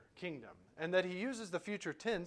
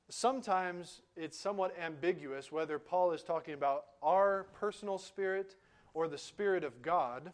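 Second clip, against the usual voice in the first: normal talking voice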